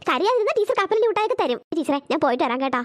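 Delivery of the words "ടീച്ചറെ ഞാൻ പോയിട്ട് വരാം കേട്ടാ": lower, fast mother rushing off; hurried mother. Acted. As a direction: brush off the teacher quickly. Mother leaving quickly.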